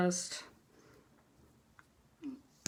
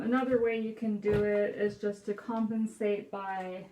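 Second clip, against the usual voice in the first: usual speaking voice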